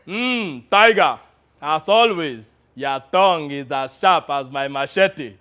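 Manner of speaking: hammed-up warlord weighing his words; African warlord. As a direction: address the lieutenant as a pompous general. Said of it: "in his best impression of an African warlord".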